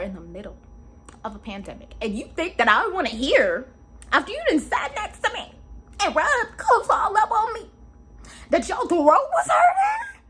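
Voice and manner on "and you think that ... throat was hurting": building from high to a shouted cry; rising, high disbelieving outrage ending in a yell; high outraged rant building to a shout. Real, not acted.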